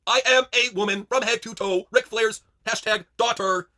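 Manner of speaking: high, loud, very slow proclamation; Charlotte Flair. Acted. Charlotte insisting to her date, loud slow proclaiming. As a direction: declaim it grandly, word by word. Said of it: "Impression Charlotte Flair goes on a date".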